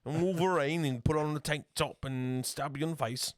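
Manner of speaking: loud, high-pitched, hammed-up Australian; terrible Australian accent. Host spoofing Hugh Jackman as Wolverine, terrible Australian accent. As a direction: yell it in an over-the-top bad Aussie accent. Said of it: "That was a terrible Australian accent".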